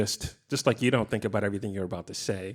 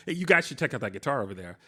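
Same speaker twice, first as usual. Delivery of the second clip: quick, higher-pitched heads-up; urgent call-out; 4 semitones higher, faster than usual. Shown as a demonstration. Guitarist showing one line three ways, quick and urgent. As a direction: rush it out to grab their attention. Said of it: "I just said the same thing".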